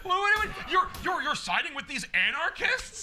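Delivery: high-pitched, loud, outraged protest; mock indignation. Green Lantern confronting his friend Ollie, hammed-up outrage. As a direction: squawk in hammed-up disbelief at a betrayal. Retold indignant reply to heckler.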